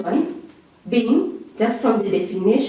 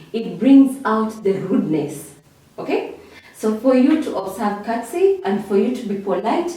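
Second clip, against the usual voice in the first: ordinary delivery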